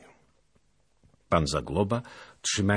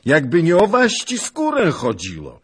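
loud, high-pitched grumbling outburst; exasperated, raised voice; resentful exclamation; 13 semitones higher, 12 dB louder than usual. A narrator's reading. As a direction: cry out with resentment at a companion. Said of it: "wykrzyknął z niechęcią".

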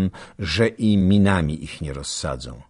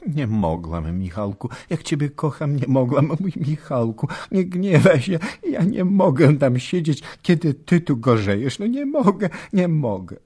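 tearful pleading, high and louder; sobbing plea; 10 semitones higher, 5 dB louder than usual. Acted. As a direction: beg husband not to be angry. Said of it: "poczęła wołać łkającym głosem". (łkający, błagalny głos)

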